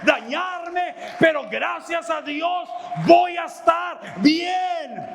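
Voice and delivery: preacher's firm vow of freedom; preached resolve. Acted. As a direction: model the brave answer for them. Preaching a model refusal.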